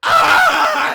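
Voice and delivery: very high, loud hammed-up scream of pain; mock scream. Tortured prisoner screaming in agony, mock scream. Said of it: "that guy's like".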